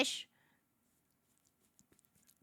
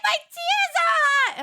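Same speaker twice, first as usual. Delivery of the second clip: very high, loud mock wail; hammed-up squeal of spicy pain; mock pained brother; 4 dB louder than usual. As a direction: shriek it like a brother in agony. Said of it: "He's like".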